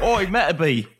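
put-on a shaky stab at an Australian accent; attempted Australian accent. Imitating an anime character's accent from the show, attempted Australian. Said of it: "like, an Australian accent".

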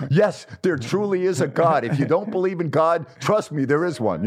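retelling a giddy rush of joy; giddy excitement. Retold or imitated. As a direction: gush like you just got your dream job. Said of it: "I'm like".

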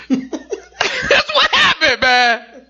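high, drawn-out words through laughter; laughing. Real, not acted. Host laughing at his own story with co-host, laughing. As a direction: squeeze the words out while cracking up.

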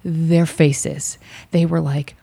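ordinary delivery

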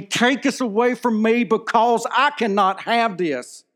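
begging God from his knees, voice strained high; desperate prayer. Retold or imitated. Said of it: "I got in that break room and I broke down".

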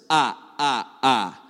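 staccato cartoon count's laugh; Count Von Count. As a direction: punctuate it with three separated mock laughs. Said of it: "the Count would absolutely love".

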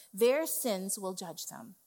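normal talking voice

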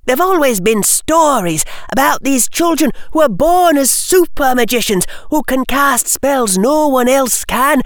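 put-on high, eager girlish rush; rapid chatter. Lavender explaining legends of super magicians, rapid chatter. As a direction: rattle it off in a high girl's voice. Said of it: "speaking very rapidly".

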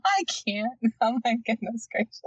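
crying with laughter, barely talking; laughing to tears. Real, not acted. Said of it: "My eyes are watering".

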